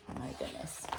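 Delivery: breathless hushed whisper; shocked whisper. Acted. Shocked reaction to the wolf's plan.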